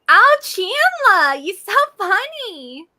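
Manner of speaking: put-on high, drawn-out New York sitcom girlfriend; Janice from Friends. Shown as a demonstration. Guest answers a request with a high, stretched sitcom impression. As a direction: stretch each word high and loud. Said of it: "Janice out of Friends".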